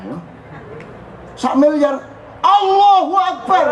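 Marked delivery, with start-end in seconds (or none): 1.4-3.7 much higher and louder, drawn out slowly in amazement; awed exclamation. Shown as a demonstration. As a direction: cry out Allahu akbar in stunned wonder. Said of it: "Jadi Allahu akbar judulnya adalah takjub".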